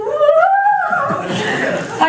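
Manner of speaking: long, very high squeal of joy; re-enacted scream of delighted shock; joyful scream. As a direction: squeal it out at the top of the range. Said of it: "I scream".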